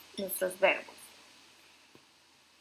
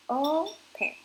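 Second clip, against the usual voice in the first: "Open" in two beats, the O higher and stronger; stress on O; 6 semitones higher, 3 dB louder than usual. Shown as a demonstration. Teacher showing the rule applies, first syllable of "Open" stressed. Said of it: "O suena más fuerte".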